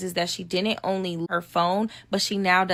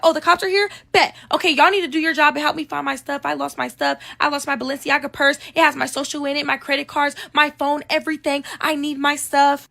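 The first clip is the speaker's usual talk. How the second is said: put-on demanding drunk voice; 6 semitones higher than usual. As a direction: insist the cops find your stuff. Drunk friend demanding from police.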